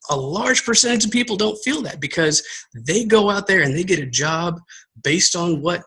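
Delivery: ordinary delivery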